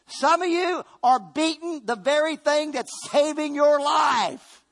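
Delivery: high, loud, slow preacher's cry building to a shout; preaching shout. Acted. Preacher warning the congregation, rising to a shout. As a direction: drive it home to the church.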